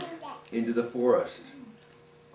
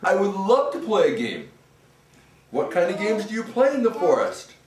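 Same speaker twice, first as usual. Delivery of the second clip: higher, delighted ghost; eager ghost; 8 semitones higher, 3 dB louder than usual. Acted. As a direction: gush about games. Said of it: "And the ghost said".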